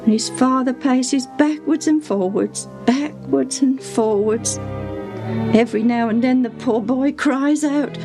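high, plaintive, worried servant; acted tearful lament. A narrator's reading. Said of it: "she said as she mopped her tears".

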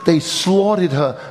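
slowed, heavy, grief-stricken quote; a mild touch of a grieving man's voice; slow grieving words. Retold or imitated. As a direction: let the loss weigh on each word.

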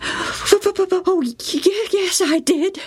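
put-on high, stammering child near tears; upset boy's voice; tearful boy. A narrator's reading. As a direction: tell of the taunts, hurt.

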